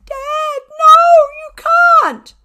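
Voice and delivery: put-on high, loud desperate cry of a little girl; pleading child. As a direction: wail it like a child begging her father. Ellie throws herself at father.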